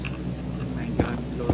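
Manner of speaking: breathy whispered gasp of disbelief; whispering. Real, not acted. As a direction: whisper it under your breath. Sudden hushed reaction.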